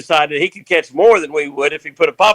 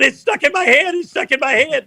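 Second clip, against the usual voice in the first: mock panic; 10 semitones higher than usual. Hooked man voiced in story.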